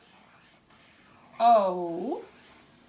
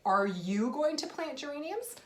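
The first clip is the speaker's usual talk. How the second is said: higher, rising question on going; question tune; 6 semitones higher than usual. Shown as a demonstration. Teacher demonstrates question intonation for kids. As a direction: ask it with a clear rising lift. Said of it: "I could say".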